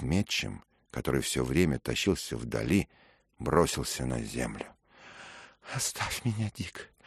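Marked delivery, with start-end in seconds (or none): 5.7-6.9 breathless, soft gasping plea; out of breath, begging to be left. A narrator's reading. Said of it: "задыхаясь, крикнул он".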